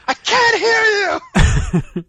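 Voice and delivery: very high, loud taunting shout; mock not hearing. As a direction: holler as if pretending to be deaf. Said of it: "instead of yelling back, I said".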